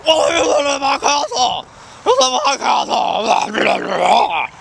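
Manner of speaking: high, ranting king voice; mock outraged shouting. King yelling at intruders who opened his room, mock shouting.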